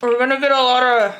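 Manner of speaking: talking around a pen in the mouth; words blurred by a pen between teeth. Real, not acted. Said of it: "sorry, I got my pen in my mouth".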